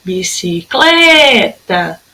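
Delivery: "Bicicleta" drawn out on the stressed "cle"; calling the word. Shown as a demonstration. Teacher calling the word, stretched "cle" in "Bicicleta". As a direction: call "Bicicleta" with a very long "cle". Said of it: "Vou chamar ela".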